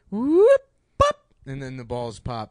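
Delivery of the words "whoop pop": high squeaky whoop and pop; cartoon pop sounds. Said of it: "it goes".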